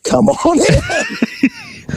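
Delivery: very high, very slow sing-song invitation; drawn-out squeaky welcome; mock dealer welcome. Dealer inviting the informant in, high slow sing-song. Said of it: "He opened the door and said".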